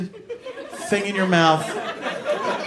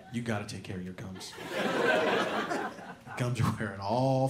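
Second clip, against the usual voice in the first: low, soft, serious confiding; hushed warning; 9 semitones lower, 11 dB quieter than usual. Acted. Comedian warning the audience about gums in a low hush. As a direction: lean in and warn them gravely. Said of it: "warns the audience about gums".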